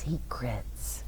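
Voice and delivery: breathy whisper of secrets. Acted. Teacher reads to the children, whispering the secret.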